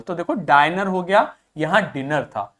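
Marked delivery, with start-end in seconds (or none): 0.3-0.9 "डाइनर" with the long "eye" sound; long vowel. Shown as a demonstration. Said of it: "pronounced as a long vowel". 1.8-2.2 "डिनर" with a short i; short vowel. Shown as a demonstration. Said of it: "यहां छोटा ही था".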